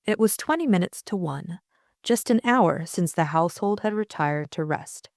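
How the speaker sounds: plain reading voice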